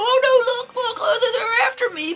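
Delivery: high, breathless panicked plea; frightened girl. Retold or imitated. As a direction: play a terrified girl on the run. Frightened girl fleeing trolls.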